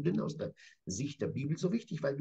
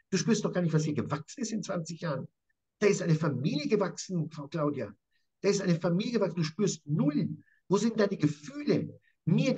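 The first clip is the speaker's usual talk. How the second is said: angry tirade; 3 semitones higher than usual. Acted. Jakob raging at Laban.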